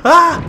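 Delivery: sharp gasp into a high, loud yelp; gasp, then yelp in fright; startled gasp and cry. Real, not acted. Gamer caught as the power runs out, startled gasp.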